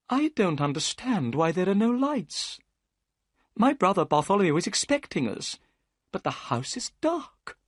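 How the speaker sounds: high, eager gentleman's voice; Thaddeus Sholto. A narrator's reading. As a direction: lift it up as the anxious host. Thaddeus puzzled at dark house.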